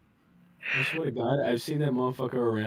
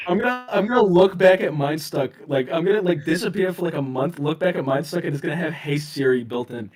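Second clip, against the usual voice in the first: excited; 6 semitones higher, 7 dB louder than usual. Real, not acted. Laughing rant about voice activation.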